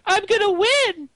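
very high, slow whiny princess voice; squeaky cartoon princess mockery; Lumpy Space Princess voice. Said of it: "Was that the Lumpy Space Princess voice?".

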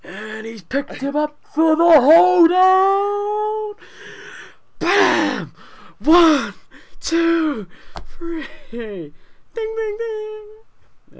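slow, very high and loud ringside call; wrestling announcer. Pretend. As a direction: call the slam like a hyped ring announcer, bell and all. Play-by-play of a wrestling move.

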